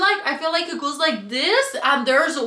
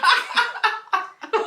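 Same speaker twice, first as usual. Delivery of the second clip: giggling laugh; 6 semitones higher than usual. Real, not acted. Laughing while telling.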